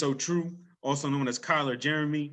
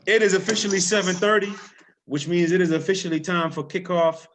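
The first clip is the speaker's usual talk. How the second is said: announcer; 5 semitones higher, 6 dB louder than usual. Acted. Announcing kickoff.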